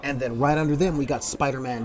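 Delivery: ordinary talk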